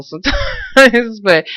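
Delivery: giggling at oneself; real laughing. Real, not acted. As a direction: laugh bursting out at the end. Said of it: "that's why I'm laughing".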